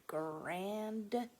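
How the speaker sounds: ordinary delivery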